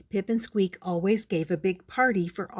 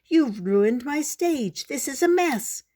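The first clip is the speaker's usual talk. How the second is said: indignant complaint; 6 semitones higher than usual. Acted. Squeak scolding Pip about the stage, upset. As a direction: scold him for the mess. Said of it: "shouted Squeak".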